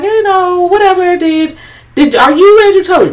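high-pitched mock questioning; acted-out questioning. Retelling the questioning to a friend, high mock voice. Acting out movie dialogue.

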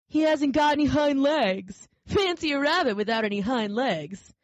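taunting wild rabbit voice; mocking wild rabbit. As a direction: jeer at the toy rabbit.

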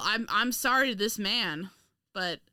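ordinary talk

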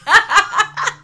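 high, loud, fast laughing; laughing hard. Real, not acted. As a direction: howl with laughter at the image. Picturing someone at the waterfall.

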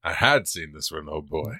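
low, soft voice still in character; hushed leftover character voice; lingering put-on voice. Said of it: "are you gonna do that all this episode".

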